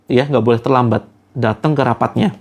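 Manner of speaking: ordinary talk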